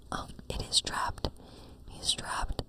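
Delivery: usual speaking voice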